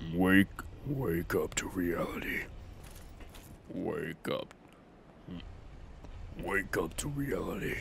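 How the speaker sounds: low, soft deep-voice impression; put-on deep character voice. Shown as a demonstration. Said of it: "figured out how to do a deep voice".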